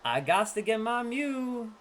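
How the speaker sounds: high excited pack-opening energy; hyped. Real, not acted. Opening a booster pack, hyped and high.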